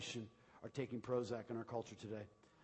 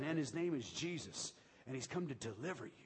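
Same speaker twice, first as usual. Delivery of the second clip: ordinary delivery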